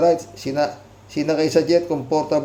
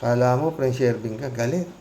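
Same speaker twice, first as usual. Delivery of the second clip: usual speaking voice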